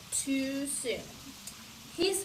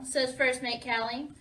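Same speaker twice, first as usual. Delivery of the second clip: plain reading voice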